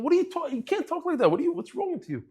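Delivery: indignant teacher scolding a student; scolding rebuke. Retold or imitated. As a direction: tell him off in disbelief. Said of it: "So I said".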